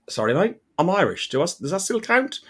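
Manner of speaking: imitated comeback, climbing high at the end; retold cheeky query. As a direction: ask it back with a high, questioning lift. Retold comeback to men threatening him.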